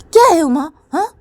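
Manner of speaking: high, loud indignant scolding; big sister scolding her little sister, high and loud. Acted. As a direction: tell her off sharply. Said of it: "और बोलती कि".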